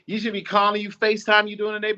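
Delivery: ordinary delivery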